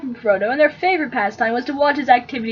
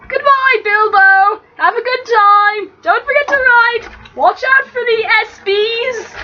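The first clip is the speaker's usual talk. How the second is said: cheery farewell; 9 semitones higher than usual. Acted. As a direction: wave him off with a cheerful shout. Frodo calls farewell to uncle.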